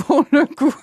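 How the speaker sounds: laughing through the words; amused laugh. Real, not acted. Host laughs at the joke.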